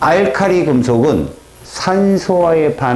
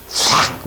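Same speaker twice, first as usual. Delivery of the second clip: whispered fizz; 4 dB quieter, much slower than usual. Shown as a demonstration. Imitating reaction noise.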